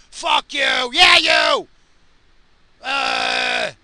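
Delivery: yelling high and loud through the windscreen; furious roadside yell; road rage shout. Real, not acted. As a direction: scream it at the other driver. Road rage at another driver.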